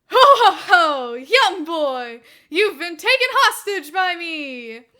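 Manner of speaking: loud, hammed-up villain laugh and boast; maniacal villain. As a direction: ham it up as a cartoon villain. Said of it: "laughed maniacally".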